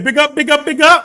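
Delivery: high, loud hype shout; loud mock hype call; Podesta. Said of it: "Podesta said".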